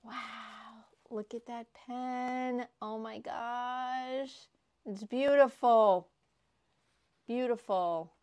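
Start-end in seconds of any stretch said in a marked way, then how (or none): none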